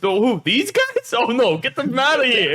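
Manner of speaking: mock drunken indignation; Ryan. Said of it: "Drunk Ryan goes".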